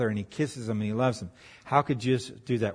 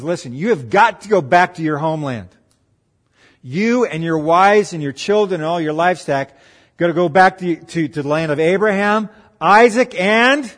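loud, insistent command, building higher; emphatic ordering; 4 semitones higher, 7 dB louder than usual. Acted. Uncle tells Jacob to go back home, loud and emphatic. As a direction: voice an uncle laying down the law, acted.

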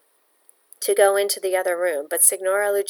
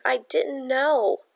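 slow, higher small whimper; 6 semitones higher, much slower than usual. Acted. Frightened girl to Pietro, slow whimper. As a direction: trail off helplessly, slow and small. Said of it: "Bella whimpered".

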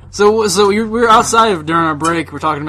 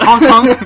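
clown horn honk; 5 semitones higher, 8 dB louder than usual. Retold or imitated.

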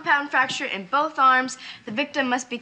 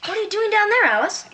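usual speaking voice

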